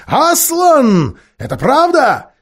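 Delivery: loud, high-pitched witch's alarm; shrieked outcry. A narrator's reading. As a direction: shriek it, pitch shooting far up. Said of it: "вскричала колдунья".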